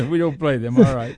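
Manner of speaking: laugh, then repeat the words still chuckling; amused echo of the joke. Real, not acted. Host laughs and echoes the co-host's joke about Ohio State.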